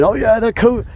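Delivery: high mocking voice of a gullible person; sneering impression of the brainwashed; mocking quote. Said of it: "brainwashed people saying".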